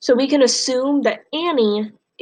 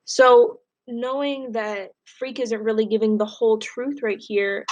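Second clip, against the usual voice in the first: usual speaking voice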